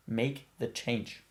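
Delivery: slow emphatic urging; slow, deliberate repeat. Real, not acted. Urging a listener to heed the inner voice, slow and deliberate. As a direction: make the change, drawn out word by word.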